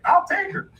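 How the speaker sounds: very high, laughing rube voice; eager hillbilly buyer. Retold or imitated. Buyer agrees to take the doll, eager hillbilly. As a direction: squeal it as a sold customer. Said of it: "he's like".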